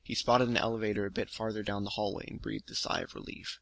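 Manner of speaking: even, steady storytelling read; story narration. A narrator's reading. Ben reads his story The Pistol to viewers, steady narration. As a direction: read the tale out evenly, letting the action carry it.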